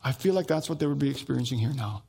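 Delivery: normal talking voice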